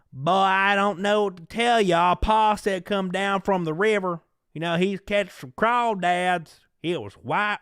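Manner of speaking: mock the cringy Hollywood Southern accent. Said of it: "they have the redneck guy".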